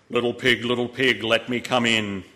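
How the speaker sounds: low, loud wolf growl; the big bad wolf at the door. A narrator's reading. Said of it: "said the wolf in his deep voice".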